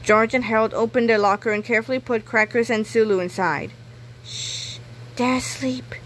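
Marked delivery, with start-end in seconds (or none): 4.2-5.9 hushed whisper, breath only. A narrator's reading. George whispering to Harold by the locker. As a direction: whisper so the pets stay asleep. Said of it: "said George".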